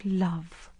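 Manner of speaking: quiet, scoffing single word; a hint of scorn; scornful. A narrator's reading. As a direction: dismiss the word with contempt. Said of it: "said the girl scornfully".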